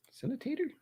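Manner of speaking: Cinnamon-tater? squeaked high and soft as a question; Cinnamon-tater? tried out in a high soft rising voice; high puzzled question. Shown as a demonstration. Streamer puzzling over a misheard chat word, high soft question.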